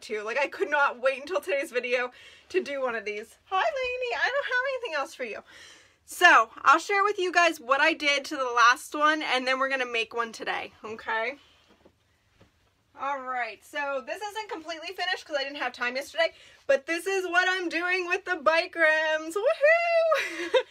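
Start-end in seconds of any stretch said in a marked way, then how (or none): 3.5-5.4 high, quick pet-talk voice; talking to the dog. Real, not acted. 19.3-20.6 high, loud, laughing woohoo; excited cheer. Real, not acted. Showing off finished project.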